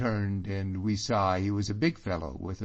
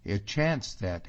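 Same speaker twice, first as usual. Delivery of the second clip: usual speaking voice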